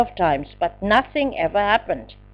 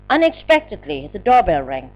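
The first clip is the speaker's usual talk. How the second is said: usual speaking voice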